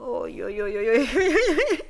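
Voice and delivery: giggly stunned yo chant; laughing disbelief. Real, not acted. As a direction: break into giggles mid-word.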